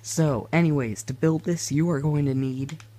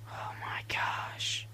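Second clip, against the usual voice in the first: whispered dismay; 10 dB quieter, much slower than usual. Real, not acted. Dismayed at a game mishap.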